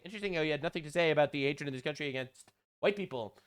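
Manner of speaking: ordinary delivery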